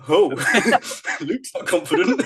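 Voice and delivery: laughing tease. Retold or imitated. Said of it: "I'm a bit like".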